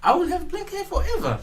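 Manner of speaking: very high, playful squeak; squeaky high voice. Pretend. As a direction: squeak it out like a cheeky kid. Teasing claim in argument.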